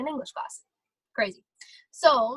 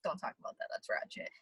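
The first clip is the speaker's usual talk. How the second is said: hushed, embarrassed whisper; whispering to the boyfriend, embarrassed by old cheap clothes; 5 semitones lower, 10 dB quieter than usual. Retold or imitated. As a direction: whisper it like you're ashamed it comes up.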